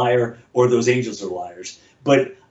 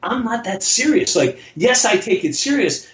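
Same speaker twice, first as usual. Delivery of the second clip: ordinary talk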